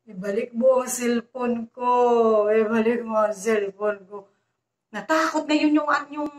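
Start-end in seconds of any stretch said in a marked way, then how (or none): 0.1-4.2 ghostly voice. Retold or imitated. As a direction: moan it like a ghost demanding its phone back. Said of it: "gano'n na naman daw naririnig niya".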